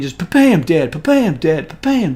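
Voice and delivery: mock gunshots. Retelling robots being shot dead.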